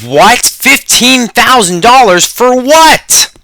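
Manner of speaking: high, loud, slow disbelief at the bill; shocked outrage. Acted. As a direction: hit the roof at the price. Fred shocked at the price.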